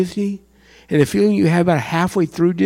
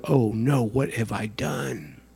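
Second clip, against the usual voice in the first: dismay; 8 semitones lower, 9 dB quieter, slower than usual. Retold or imitated. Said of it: "and go".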